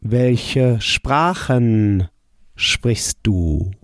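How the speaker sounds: slow "Welche Sprachen sprichst du?"; careful teacher pace; slow model answer. Shown as a demonstration. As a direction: model the question slowly. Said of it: "How would you say".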